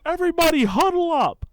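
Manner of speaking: re-enacted field-wide shout; coach rallying team. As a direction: call the whole team in, imitating a coach. Coach gathers whole team.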